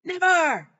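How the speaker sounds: put-on loud, high child's yell; screamed defiance. Boy screams refusal into her face, full shriek. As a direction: scream it as loudly as he could.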